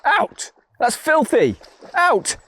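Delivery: shouting at dog. Real, not acted. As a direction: shoo the dog away sharply.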